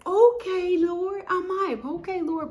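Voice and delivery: high, loud excited squeal to God. Retold or imitated. Thrilled at a job callback, high excited squeal. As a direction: hype it up high. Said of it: "So I'm like".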